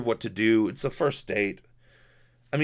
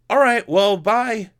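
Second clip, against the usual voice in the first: retold call after her; 9 semitones higher, 6 dB louder, slower than usual. Calling after date who bolts.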